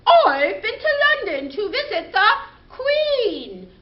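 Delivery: chirpy kitty answering proudly; pussycat puppet voice. Pretend. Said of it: "we need our friend the pussycat".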